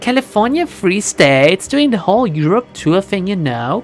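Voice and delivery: put-on high partying Valley Girl; gushing slow Valley Girl; Valley Girl accent. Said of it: "American Valley Girl".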